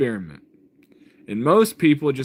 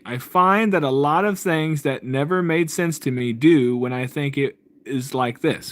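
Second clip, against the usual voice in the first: slow, careful reading aloud; read slowly. Shown as a demonstration. Reading a viewer's comment aloud slowly so it lands. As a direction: take each word slowly, like reading it out. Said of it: "I'm gonna read this slowly".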